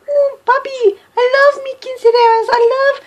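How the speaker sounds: very high, hammed-up pony voice; mock pony voice. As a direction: gush like a cartoon pony in a far-too-high voice. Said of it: "They didn't sound like that".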